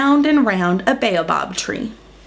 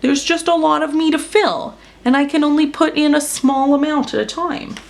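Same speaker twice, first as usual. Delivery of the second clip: higher, put-upon grumble; grumbling; 6 semitones higher than usual. Acted. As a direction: explain it like a sulky giant. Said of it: "grumbled Elephant".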